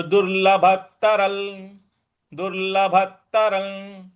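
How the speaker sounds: दुर्लभतरल with the anusvara blended into ल; दुर्लभतरल, nasal running into the ल; anusvara joined to la. Shown as a demonstration. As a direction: say दुर्लभतरल slowly, linking the nasal into ल. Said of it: "इस प्रकार से बोलेंगे".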